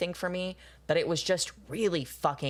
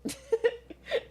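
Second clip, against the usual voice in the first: high burst of laughter. Real, not acted. High laugh after joke.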